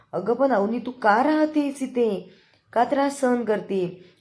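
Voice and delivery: dismayed pleading. Retold or imitated. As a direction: ask why she endures this. Sister-in-law pleads, dismayed. (हताश होऊन विचारणे)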